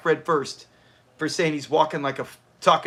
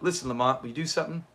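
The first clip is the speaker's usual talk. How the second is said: lightly imitating Fred; 2 semitones lower, 7 dB quieter, faster than usual. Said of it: "Fred should have did it like that".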